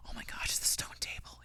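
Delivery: hushed, breathless whisper of discovery; murmured in awe; awed whisper. Retold or imitated. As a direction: whisper it like an audience gasping in the dark. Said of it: "small murmuring throughout the audience".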